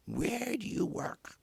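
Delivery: put-on high, slow, pointed old lady's question; a lady after the concert asks where he works, in a high imitated voice; imitating an old lady. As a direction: poke him with a prim high-voiced question.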